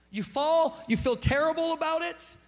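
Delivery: loud preacher's cry; preaching shout. Real, not acted. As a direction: preach it out loud at full force. Preaching climax on the shame loop.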